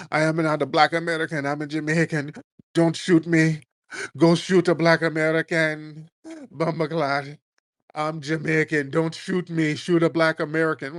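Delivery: mock Jamaican accent. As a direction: mimic a Jamaican lady protesting in her accent. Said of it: "this Jamaican lady".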